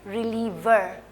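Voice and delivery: रिलीवर slowed right down, with the r sound added at the end; relieve plus r. Shown as a demonstration. Teacher slowly modelling रिलीवर for students. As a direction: stretch out रिलीवर and sound its r ending. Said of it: "आर के साथ".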